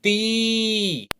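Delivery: ती said with a long held ई; drawn-out ती for pupils. Shown as a demonstration. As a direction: hold the ई of ती. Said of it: "और अब बोलेंगे कैसे?".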